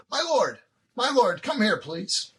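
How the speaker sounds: elf calls his lord, pitched high; urgent elf Hawthorne. Acted. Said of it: "he says".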